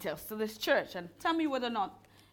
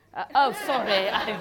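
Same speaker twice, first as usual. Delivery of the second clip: real laughter breaking through louder words; giggling apology after a slip; laughing; 2 semitones higher, 7 dB louder than usual. Real, not acted. Preacher apologizing to congregation through real laughter.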